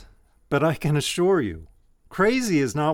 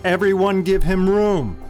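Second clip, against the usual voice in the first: calling out; 5 semitones higher, 4 dB louder than usual. Acted. Abbot clearing space around wounded monk.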